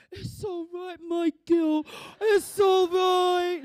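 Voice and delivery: high, loud, drawn-out cry of comfort; wailing reassurance. Retold or imitated. Dad forgiving his pregnant girl, loud wailing reassurance. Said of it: "he just goes".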